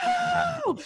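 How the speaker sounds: put-on very high wailing little boy voice; child wailing. Sick son refusing to puke, very high wail. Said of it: "he's just going".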